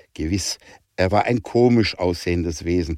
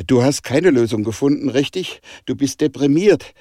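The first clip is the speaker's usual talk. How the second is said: higher, louder, despairing challenge; desperate; 7 semitones higher, 4 dB louder than usual. A narrator's reading. Danica confronts Jonah after no solution, desperate. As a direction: press the questions upward in mild desperation. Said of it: "sagte Danica verzweifelt".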